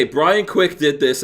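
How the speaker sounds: usual speaking voice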